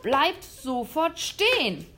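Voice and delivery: loud, slow barked command; a hunter yelling after the fleeing boy; shouted order. Acted. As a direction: bark the order at them. Hunter orders them to stop.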